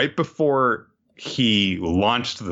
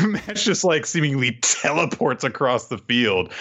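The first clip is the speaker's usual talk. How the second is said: usual speaking voice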